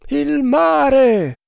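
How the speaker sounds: high, drawn-out lookout's shout; shouted cry. A narrator's reading. Sailor up the mast sighting the sea, shouting. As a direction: call it out loud and long from aloft. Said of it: "gridò il marinaio".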